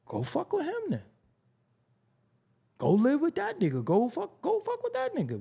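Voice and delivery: high, incredulous mock rant; a hammed-up voice for a fed-up friend telling you to go; mock angry outburst. To a friend who defends the violator, a high mock angry outburst.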